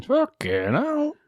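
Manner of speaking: slow, high, drawn-out exasperation; exasperated groan. Real, not acted. Host groaning at yet another Frank Morgan role, drawn out and high. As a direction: stretch it up in mock despair. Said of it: "groans at Frank Morgan again".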